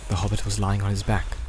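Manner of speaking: ordinary delivery, nothing marked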